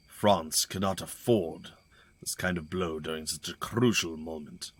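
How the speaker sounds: lightly voiced game characters, a touch urgent; dialogue read-through. Streamer reading the soldiers' dialogue to viewers, lightly voiced. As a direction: read the lines like a cutscene, little acting.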